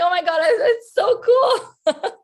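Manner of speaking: slow, high and loud, half crying half laughing; real tears breaking into a laugh; laughing through tears. Real, not acted. Widow looks at her husband's ornament now, laughing while crying.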